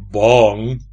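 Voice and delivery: big, loud looming voice; weighty, forceful sudden question; heavy voice. A narrator's reading. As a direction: boom it in a heavy voice. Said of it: "een zware stem vlak bij zijn ene oor".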